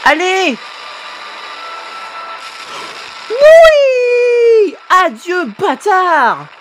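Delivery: root hard for the winning shot; shouted high encouragement; excited cheering. Real, not acted. Cheering the final blow.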